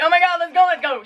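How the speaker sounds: high, hurried plea to leave; urgent outburst. Retold or imitated. Injured kid urging parents to hospital, retold urgency. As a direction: imitate urging everyone out the door.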